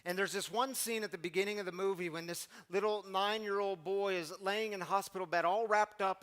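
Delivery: ordinary delivery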